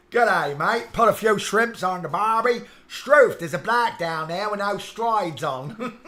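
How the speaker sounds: loud, high, hammed-up Australian accent; mock Australian. English streamer mocking those who think he's Australian, hammed-up Australian accent. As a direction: belt out a caricature Aussie greeting. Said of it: "people that think I'm Australian".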